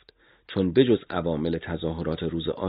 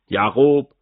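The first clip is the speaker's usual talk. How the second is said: sharp call; 6 semitones higher, 7 dB louder, slower than usual. Acted. Interrogator calls the torturer.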